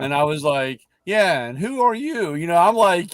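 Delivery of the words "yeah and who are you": higher-pitched suspicious challenge; retold wariness. Father to unknown man at the seven eleven, retold suspicious challenge. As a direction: re-enact sizing up a nosy stranger.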